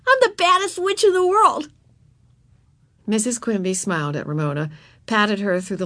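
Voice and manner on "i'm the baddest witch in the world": put-on high, gleeful child boast; excited cry. A narrator's reading. Ramona in full witch costume boasts to Mama, high excited cry. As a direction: proclaim it as a proud little girl. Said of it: "she cried".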